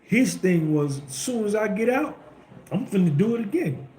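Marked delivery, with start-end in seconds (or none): 1.1-3.9 retold upbeat vow, pitched high. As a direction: imitate his eager, high-pitched energy. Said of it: "he was so upbeat".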